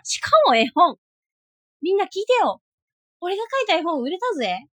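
loud, high, thrilled shout; excited shout. Real, not acted. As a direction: call out the big news. Excited announcement of sale. (大喜びで叫ぶ)

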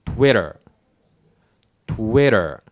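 TWITTER as "TWEH-der" with tapped T and R ending; English pronunciation. Shown as a demonstration. Teacher putting the sounds together, American pronunciation. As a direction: round the lips, then TWITTER smoothly, American-style. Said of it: "じゃあ一緒にね".

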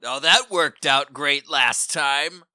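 ordinary delivery

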